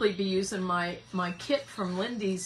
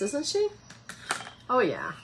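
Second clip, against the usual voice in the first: normal talking voice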